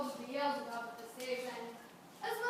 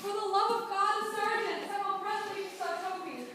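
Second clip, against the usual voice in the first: panicked call for help; 6 semitones higher, 7 dB louder than usual. Acted. Wounded knight cries for help.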